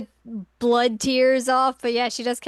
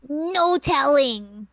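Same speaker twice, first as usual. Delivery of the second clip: loud, slow, higher mock refusal; stubborn refusal; 4 semitones higher, 5 dB louder, much slower than usual. Kagome refusing to tell why she's sad, mock stubborn. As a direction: stretch out a hammed-up refusal. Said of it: "and she's like".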